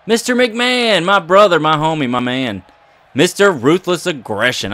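starts high then settles, fawning over the boss; butter up the boss with playful overexcitement; mock hype. Hyping up boss Vince in game.

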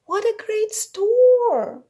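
put-on high, loud, slow delighted girl; little girl voice. A narrator's reading. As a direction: gush like an excited little girl. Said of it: "she said".

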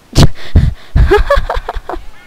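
high unvoiced cackle of laughter; burst of laughter. Real, not acted. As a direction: break into wild laughter. Laughing at chaos in game.

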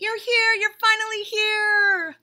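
high, loud, slow "finally" squealed with joy; excited shout. Acted. Bunny bursting with joy as the reader arrives, high and loud. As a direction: greet them like a long-lost friend. Bunny's over-the-top greeting.

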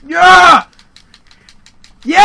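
loud, high yeah; victory shout. Real, not acted. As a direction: celebrate the hit. Triumph in game.

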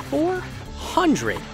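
high-pitched, drawn-out amazement; amazed emphasis. Real, not acted. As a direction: punch out the figure in high-voiced awe. Stressing an amazing game stat.

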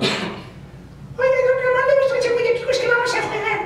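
put-on squeaky chubby kid calling out; Ñoño voice. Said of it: "Y Ñoño".

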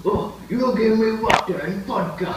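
put-on Russian accent. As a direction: demand it in a hammed-up Russian accent. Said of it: "Okay, Russian".

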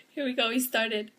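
normal talking voice

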